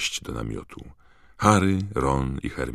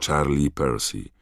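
ordinary delivery, nothing marked

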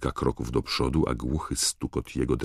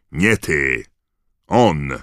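two loud, punched exclamations; Moody's shouted order; 8 dB louder, much slower than usual. A narrator's reading. Moody corrects Harry and points at Crabbe, shouting. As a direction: call out sharply to single someone out. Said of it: "krzyknął Moody".

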